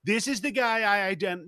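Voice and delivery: high-pitched mock disbelief; mock incredulous. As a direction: act out an appalled viewer, pitched high. Mocking viewer's outraged identification with hero.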